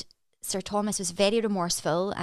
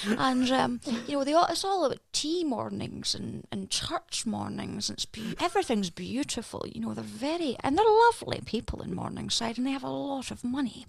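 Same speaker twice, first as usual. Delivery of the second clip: put-on higher, genteel Morningside Edinburgh accent with a sibilant S; 5 semitones higher than usual. Asked by a friend to do the accent, a higher Morningside Edinburgh accent. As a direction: play a Morningside Edinburgh lady at a tea morning. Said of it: "where they all sort of speak like this".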